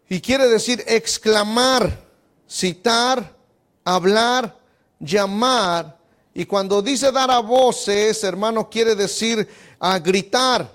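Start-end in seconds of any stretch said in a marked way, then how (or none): none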